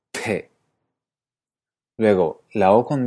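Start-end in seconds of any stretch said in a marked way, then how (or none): 0.1-0.5 P with a puff of air; a breathy aspirated P. Shown as a demonstration. Teacher shows the Icelandic b as aspirated p. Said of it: "una P con aspiración".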